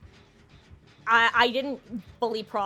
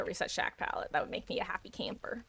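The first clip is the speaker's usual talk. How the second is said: fast little whisper; whispered aside; 4 semitones lower, 10 dB quieter than usual. Real, not acted. Streamer musing to herself, whispered aside. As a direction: whisper it to yourself.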